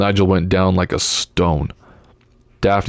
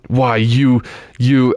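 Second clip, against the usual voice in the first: sputtering anger; 4 semitones higher, much slower than usual. A narrator's reading.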